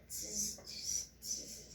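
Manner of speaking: short unvoiced teasing tss, tss, tss; mock the peddler like a cheeky monkey; monkey tss sounds.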